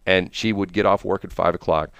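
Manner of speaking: normal talking voice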